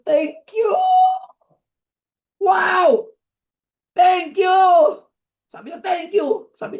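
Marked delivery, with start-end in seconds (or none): 0.1-4.5 high, loud ecstatic shouting; twin's wild joyful thank you; overjoyed thanks. Retold or imitated. Twin thrilled by horse dung gift. 5.8-6.5 excited thanks. Retold or imitated. Twin thanking again.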